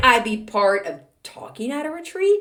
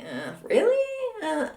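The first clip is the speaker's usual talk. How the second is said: mock disappointed fan; 8 semitones higher, slower than usual.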